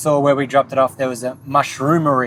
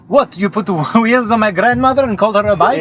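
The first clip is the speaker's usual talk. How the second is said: high, louder, laughing put-on outrage; mock outraged Italian; 8 semitones higher, 4 dB louder than usual. Retelling the Italian cook's retort on the show, mock outrage with a laugh. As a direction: play the offended Italian cook, laughing through it. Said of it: "and she's like".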